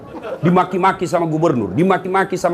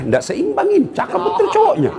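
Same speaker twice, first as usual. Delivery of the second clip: squeaky, much higher, laughing through it; high mocking teen voice; 13 semitones higher than usual. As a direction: mimic a gushing schoolgirl, pitch way up. Repeats the praise, mocking the match.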